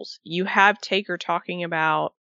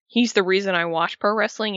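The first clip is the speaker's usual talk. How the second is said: ordinary talk, nothing marked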